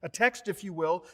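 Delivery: usual speaking voice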